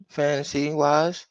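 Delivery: ordinary talk, nothing marked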